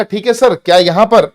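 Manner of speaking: teacher imitating a doubtful student's question; student asking. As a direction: voice a puzzled student asking sir. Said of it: "अब mind में आएगा".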